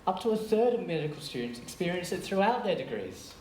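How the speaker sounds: usual speaking voice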